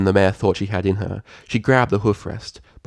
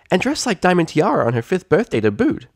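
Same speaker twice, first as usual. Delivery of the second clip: filly tease; 10 semitones higher, 3 dB louder than usual. Acted. Filly joke voiced.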